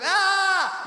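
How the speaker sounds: loud, high-pitched "ah" cry; high shouted yell. Retold or imitated. Passer-by in the street mistaken for calling, high yell. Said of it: "Someone goes".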